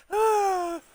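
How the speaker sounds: ordinary talk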